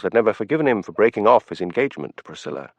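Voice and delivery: ordinary delivery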